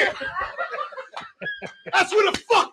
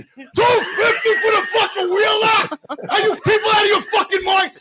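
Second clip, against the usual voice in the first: outraged shout; 5 semitones higher than usual. Retold or imitated. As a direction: yell it in disbelief. Outraged retelling of fine.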